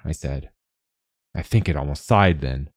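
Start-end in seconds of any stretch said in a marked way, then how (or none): none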